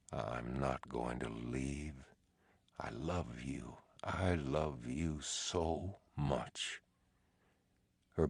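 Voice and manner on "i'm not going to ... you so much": soft, slow, breathy I love you; hushed and tender, almost whispered. Acted. Teddy tender to dead wife.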